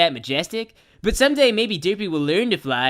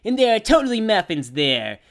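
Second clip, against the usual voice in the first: ordinary delivery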